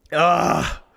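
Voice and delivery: ordinary delivery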